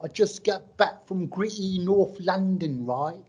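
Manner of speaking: mock a Malaysian friend putting on British airs; showy put-on London accent. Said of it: "They come back like".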